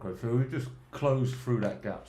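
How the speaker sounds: talking around a pen in the mouth; pen in teeth. Real, not acted. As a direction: speak with a pen between your teeth. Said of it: "Sorry, I have my pen in my mouth".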